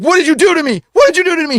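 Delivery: very high-pitched retold panic; freaking out. Released man confronts the one who prayed, freaking out. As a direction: squeal it in alarm, imitating a panicked man. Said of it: "He starts freaking out".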